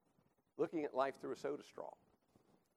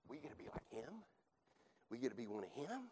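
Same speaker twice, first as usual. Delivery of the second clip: tempted whisper; 9 dB quieter, faster than usual. Acted. Adam and Eve tempted.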